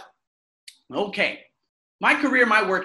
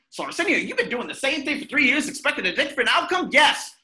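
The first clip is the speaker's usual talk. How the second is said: self-interrogation; 6 semitones higher than usual. Acted. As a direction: call himself out. Challenging himself aloud.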